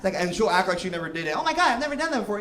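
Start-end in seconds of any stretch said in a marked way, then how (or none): 1.4-2.4 high, fast, gushing fake innocence; playing innocent in a high voice; mock girly denial. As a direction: squeal it like a shocked innocent. Mocking a friend's denial.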